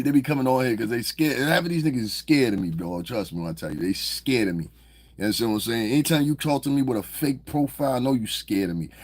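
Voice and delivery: ordinary talk, nothing marked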